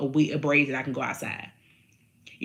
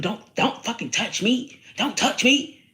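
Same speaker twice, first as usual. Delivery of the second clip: yelling; 6 semitones higher, 3 dB louder, slower than usual. Shown as a demonstration. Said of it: "I don't be yelling at people".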